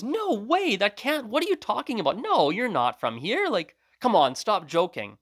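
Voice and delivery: high-pitched incredulous protest, retold; mock "you must be kidding" disbelief; imitated disbelief. Hairdresser disbelieves friend in retold story.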